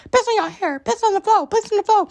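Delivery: squeaky, very high silly voice; silly taunt. Pretend. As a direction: chant it in a ridiculous high voice. Mock taunting repeated line.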